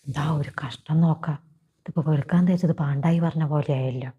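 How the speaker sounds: muttered grumble. Acted. Grumbling aside to himself.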